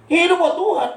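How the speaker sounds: high, emphatic pulpit slogan; mock preacher. Other pastors urging devotion to God, in a high mock preaching cry. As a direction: imitate a preacher's ringing proclamation. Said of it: "Kata orang begitu".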